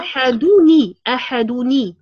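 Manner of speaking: أحدوني with the added nun said clearly as ني; linking nun kasra. Shown as a demonstration. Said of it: "الساكن الأول سيكون مكسور". (أحدوني بنون ساكنة مكسورة)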